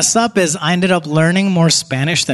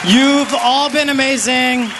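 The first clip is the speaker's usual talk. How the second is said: upbeat sign-off; 7 semitones higher than usual. Real, not acted. Comedian's high sign-off to crowd.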